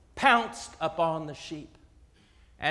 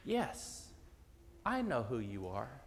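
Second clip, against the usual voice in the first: softer, unhurried calm answer; calm reply; 5 semitones lower, 4 dB quieter than usual. Acted. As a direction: answer the wolf with quiet, steady peace. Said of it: "the sheep calmly answered".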